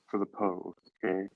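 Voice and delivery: ordinary talk, nothing marked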